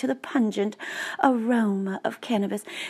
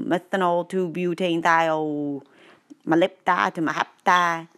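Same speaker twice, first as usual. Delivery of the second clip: ordinary delivery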